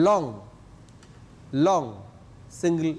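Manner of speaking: usual speaking voice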